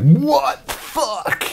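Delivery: high, slow, drawn-out what the fuck; stunned disbelief. Retold or imitated. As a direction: gape at the lights in mock shock. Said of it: "You're just like".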